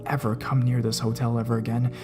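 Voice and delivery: usual speaking voice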